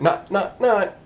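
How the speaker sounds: "not, not, not" punched high, one by one; emphatic triple not. Retold or imitated. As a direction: drive home every single "not". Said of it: "I always put the triple nots when I'm making sure you don't skip over".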